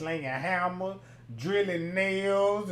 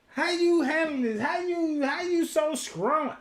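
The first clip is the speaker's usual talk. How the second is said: mock questioning; 8 semitones higher than usual. Acted interviewer questioning the mother.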